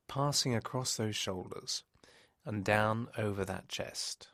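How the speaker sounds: slow, measured hypnosis voice with long pauses; hypnotic relaxation. Real, not acted. Hypnotist guiding a listener to close their eyes and relax, slow and soothing.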